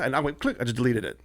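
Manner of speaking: ordinary talk, nothing marked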